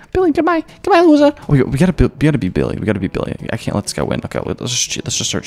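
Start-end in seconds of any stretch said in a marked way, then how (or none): 0.1-1.3 high sing-song mock farewell; mocking taunt. Player mocking rival Billy as he leaves, high taunt. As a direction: taunt the rival in a much higher voice.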